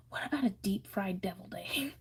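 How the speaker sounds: breathy hushed question; whispering. Real, not acted. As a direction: whisper it like a secret. Reads comment in hushed aside.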